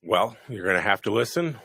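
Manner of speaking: usual speaking voice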